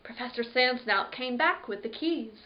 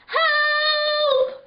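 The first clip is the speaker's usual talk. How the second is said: very high, loud scream for help; 10 dB louder than usual. Acted. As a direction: scream it from inside the coffin. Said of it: "I screamed at the top of my lungs".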